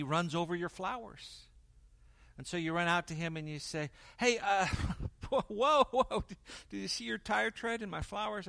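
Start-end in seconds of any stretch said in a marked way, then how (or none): 4.2-8.5 high, loud, outraged call to the neighbor; indignant shout. Acted. Homeowner confronting neighbor who drove over flowers, indignant shout. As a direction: yell at him across the yard.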